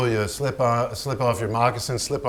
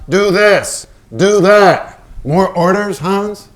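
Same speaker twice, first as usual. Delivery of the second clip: higher, louder sarcastic jabs; angry mockery; 7 semitones higher, 9 dB louder, slower than usual. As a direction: throw his orders back at him. Pollock mocking Hans's orders.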